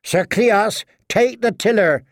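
high-pitched firm order; commanding. A narrator's reading. Woman commanding her companions on the boat, commanding. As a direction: give orders to the crew. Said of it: "the wench commanded".

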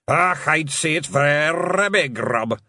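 high, slow, loud pixie brogue; deadpan Scots understatement. Acted. William answering Rob at the oar, slow Scots pixie voice.

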